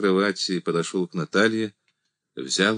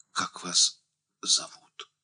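slow, whispered question; hushed and halting, almost voiceless; quiet question; 4 dB quieter, slower than usual. A narrator's reading. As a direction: ask it faintly, drawing the words out. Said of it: "тихо спросил он".

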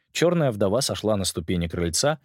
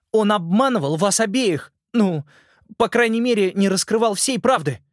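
put-on higher, louder earnest boy voice; a boy urgently pleading his case; 12 semitones higher, 4 dB louder than usual. Simon's lines.

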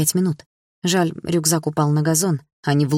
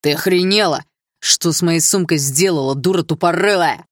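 put-on a boy's furious yell, louder than the narration; angry classmate yelling; 4 dB louder than usual. A narrator's reading. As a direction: shake with rage and spit it out. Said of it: "закричал он яростно".

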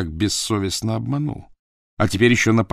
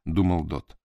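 plain reading voice

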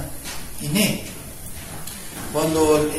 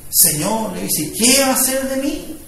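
loud, impassioned sermon voice; fervent preaching; 5 semitones higher, 6 dB louder than usual. Acted. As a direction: preach it out to the congregation. Preacher building to full force.

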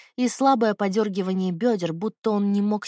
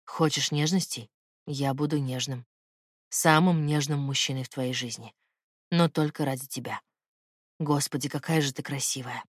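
lightly lowered man's voice, intimate; low male lover voice; 6 semitones lower, 3 dB quieter than usual. Acted. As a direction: speak low as the tender man.

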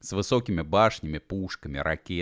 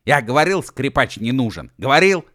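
loud, high I-told-you-so; angry shouting; 6 semitones higher, 9 dB louder than usual. A narrator's reading. Uef rails that the fiddler is useless, angry shouting. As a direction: shout it in exasperation, peaking at the end. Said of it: "закричал У Ф".

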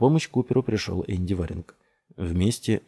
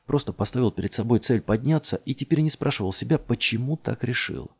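ordinary delivery, nothing marked

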